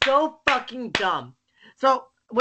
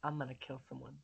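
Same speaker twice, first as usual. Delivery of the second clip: ordinary talk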